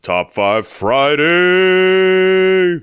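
drawn-out segment announcer call. Acted. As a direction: announce it like a show title.

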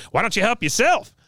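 high-pitched retort in character; Cotton. Retold or imitated. Recapper as Cotton refusing to help her up, high-pitched retort. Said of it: "He goes".

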